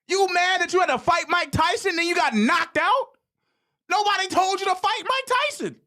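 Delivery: mocking shout at a sulking team; heated shout. Real, not acted. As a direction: yell it in disbelief. Heated rant at Texas fans.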